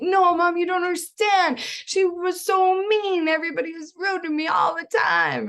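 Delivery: hammed-up sulky child insisting; whiny daughter. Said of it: "She's gonna be like".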